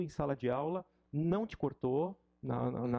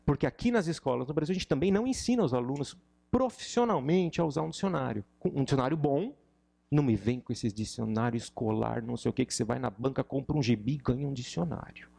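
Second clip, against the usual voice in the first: heated rant; 5 dB louder than usual. Real, not acted. Ranting about dictionaries.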